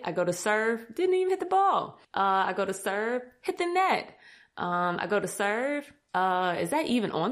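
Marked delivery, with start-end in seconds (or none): 1.0-2.0 high-pitched incredulous complaint; mock exasperation. Beginner recounting her missed serve, high mock exasperation. 3.4-4.2 mock exasperation. Retold failed serves, exasperated.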